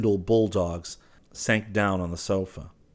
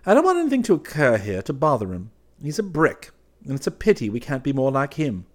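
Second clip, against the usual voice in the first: earnest, higher and insistent; more serious; 6 semitones higher, 4 dB louder than usual. A narrator's reading. Clifford defending his innocent friend, more seriously. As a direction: press the point with mild seriousness. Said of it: "then more seriously".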